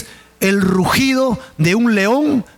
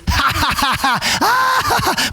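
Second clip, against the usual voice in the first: high, scornful laugh from far back; mocking laugh; 8 semitones higher than usual. Pharisees mocking the crucified man: high scornful laugh. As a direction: laugh at him like a smug Pharisee.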